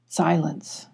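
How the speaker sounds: ordinary delivery, nothing marked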